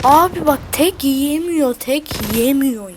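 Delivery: loud, frustrated yelling at the screen; angry shout. Real, not acted. Gamer yelling at his screen when the enemy won't die, furious shout. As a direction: shout it in disbelief, slowing on the repeat.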